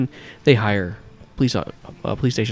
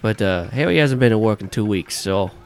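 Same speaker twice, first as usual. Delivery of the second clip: hammed-up New Yorker listing his drivers; New Yorker accent; 5 dB louder than usual. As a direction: bark the roster in a thick New York accent. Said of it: "this shitty New Yorker accent".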